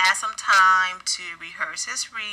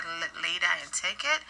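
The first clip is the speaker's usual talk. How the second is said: ordinary talk, nothing marked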